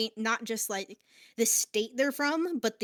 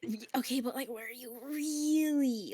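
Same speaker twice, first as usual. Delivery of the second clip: lightly imitated doubt, stretching out "really"; mock sceptical prying; 3 semitones higher than usual. Acting out people doubting their origin.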